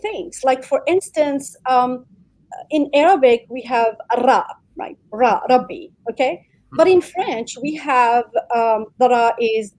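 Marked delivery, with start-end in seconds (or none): 4.3-4.4 ra with the r rolled on the tongue; rolled Arabic r. Shown as a demonstration. Speaker showing the Arabic sound, rolled r in "ra". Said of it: "requires this type of rolling". 5.1-5.9 Ra, Rabbi with a tongue-rolled r; rolled Arabic r. Shown as a demonstration. Giving an Arabic example, rolled r in "Ra, Rabbi". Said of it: "requires this type of rolling".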